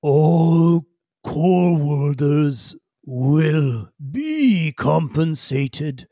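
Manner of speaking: very slow weighty alien voice; ponderous Dar Ukla. Acted. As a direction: draw each word out heavily. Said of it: "Dar Ukla ... Says".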